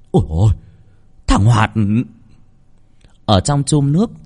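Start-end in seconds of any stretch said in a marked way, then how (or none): 0.1-1.7 startled cry. A narrator's reading. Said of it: "kêu lên".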